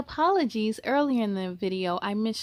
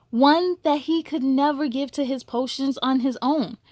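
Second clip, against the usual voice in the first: gentle, measured storybook reading; story narration; 4 dB louder than usual. A narrator's reading. Reading a picture book aloud to young listeners, gentle storytelling. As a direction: read it like a bedtime story to kids.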